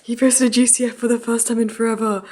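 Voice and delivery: usual speaking voice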